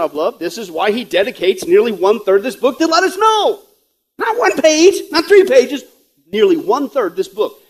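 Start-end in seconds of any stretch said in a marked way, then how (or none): 4.2-5.8 "Not one page, not three pages" punched high and loud; emphatic contrast. Real, not acted. Preacher stressing how much prophecy there is, high emphatic punches.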